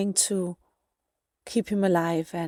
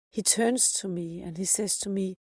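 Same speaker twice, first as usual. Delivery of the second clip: ordinary talk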